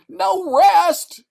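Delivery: very high, loud scream. Acted. Delirious man refusing rest, scream. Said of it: "screamed Sale".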